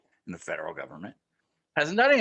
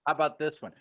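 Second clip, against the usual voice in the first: ordinary talk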